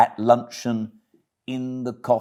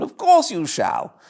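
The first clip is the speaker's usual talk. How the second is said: lightly raised, sympathetic gentleman's voice; a hint of a concerned gentleman; kindly gentleman; 12 semitones higher than usual.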